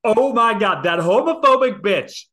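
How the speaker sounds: loud, high-pitched indignant outburst; mock outrage. Cohost reacts to the homophobic line, mock outraged. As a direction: exclaim it in scandalized disbelief.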